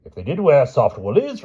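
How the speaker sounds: loud, high angry reply; king retorting to his wife furiously. Acted. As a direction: snap back at the queen. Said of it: "replied the King furiously".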